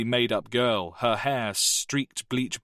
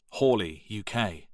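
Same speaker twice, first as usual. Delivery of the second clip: usual speaking voice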